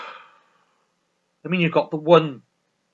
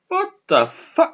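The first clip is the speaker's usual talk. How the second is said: mock bafflement; 14 semitones higher, 4 dB louder, slower than usual. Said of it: "They're like".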